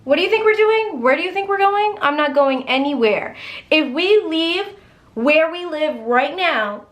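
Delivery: high-pitched, indignant mock meltdown; mock hysterical wife. Wife refusing to move, shrill hammed-up meltdown. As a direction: squeak it out like an outraged wife. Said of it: "Clemence had a f-, meltdown".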